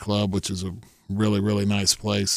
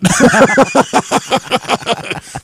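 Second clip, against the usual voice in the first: loud high-pitched laugh; real laugh breaking out high; high burst of laughter; 13 semitones higher, 8 dB louder than usual. Real, not acted. Laughing about his friends' lack of sympathy, high loud laugh.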